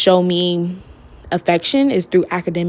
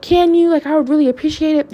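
high, fast, eager little plea; eager hopeful ask; 8 semitones higher than usual. Retold or imitated. Kid asking her teacher to bump the grade, high and eager. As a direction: jump at the offer, hoping hard.

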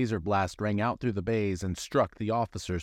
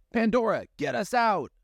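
high, louder cry to a friend; urgent call; 13 semitones higher, 4 dB louder than usual. Acted. Amanda yells to Pandora to escape, high and loud. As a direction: yell it mid-fight. Character calls during fight.

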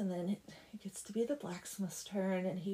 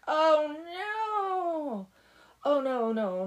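loud, high wail of dismay; mock dismay; 6 semitones higher, 7 dB louder, much slower than usual. Blacksmith finding he slept through church, mock dismay.

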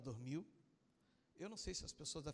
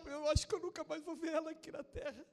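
high, loud, fervent preaching; impassioned preaching; 12 semitones higher, 6 dB louder than usual. Real, not acted. Pastor telling the congregation of her new faith, impassioned preaching. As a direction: preach it at full force, pitched high.

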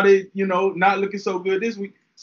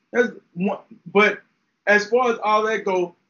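ordinary talk, nothing marked

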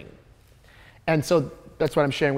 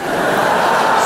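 burst of laughter; 7 dB louder than usual. Real, not acted. Audience laughs at punchline.

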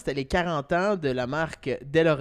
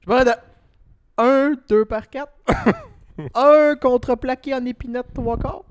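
loud customer; 8 semitones higher, 9 dB louder than usual. Acted. Acted hardware store customer.

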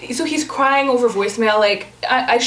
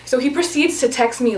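usual speaking voice

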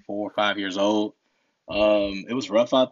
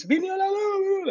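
street cheering; slower than usual. Retold or imitated. Neighbours cheering lights back.

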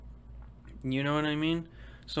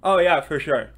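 mock answer; 10 dB louder than usual. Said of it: "And they go".

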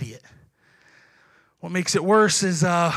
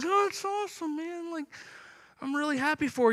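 much higher, soft forced cheer; fake enthusiasm; 6 semitones higher, 8 dB quieter than usual. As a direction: smile through the jealousy. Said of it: "inside, I was ready to explode".

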